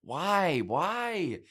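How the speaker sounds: high, disbelieving why, repeated; incredulous. Real, not acted. Podcast host baffled by the Best Picture winner, incredulous.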